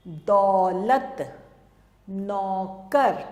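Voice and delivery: दौलत and नौकर drawn out slowly; slow classroom spelling-out; slow demo words. Shown as a demonstration. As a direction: sound out each word slowly for pupils. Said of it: "उसको बोलते हैं औ".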